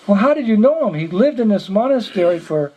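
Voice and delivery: high-pitched acted disbelief; incredulous questioning. Monk doubting the visitor knew Father Pierre, incredulous. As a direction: challenge him, voice lifted high.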